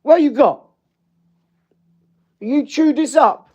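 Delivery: high squeaky voice, teasing the dog for chewing; baby talk to puppy. Acted. Owner teasing the puppy over chewed things, squeaky baby talk.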